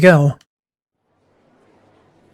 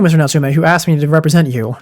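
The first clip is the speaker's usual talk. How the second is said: normal talking voice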